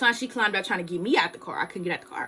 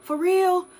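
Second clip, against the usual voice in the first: surprised disbelief; 10 semitones higher, 3 dB louder than usual. Retold or imitated. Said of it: "I was like".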